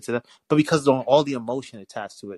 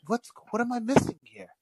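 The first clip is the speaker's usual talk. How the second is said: high, puzzled voice; baffled, pitched way up; confused; 12 semitones higher than usual. Retold or imitated. Retelling his confused reaction.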